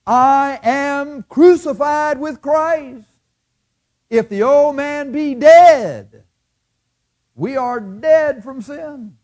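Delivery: high, loud, slow proclamation; preaching Paul. Acted. Preacher voicing Paul's words, high slow proclaiming. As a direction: declaim it slowly like scripture. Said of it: "what did Paul say?".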